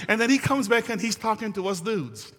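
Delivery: normal talking voice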